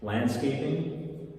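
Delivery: ordinary delivery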